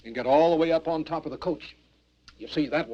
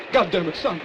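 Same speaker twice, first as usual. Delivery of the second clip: urgent yell; 3 semitones higher, 4 dB louder than usual. Acted. Injured man yells at brother.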